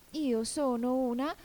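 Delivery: high, slow, rising and left hanging; hesitant. A narrator's reading. Alice searches again for what she is, hesitant. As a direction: grope upward for the missing word. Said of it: "ma con qualche dubbio".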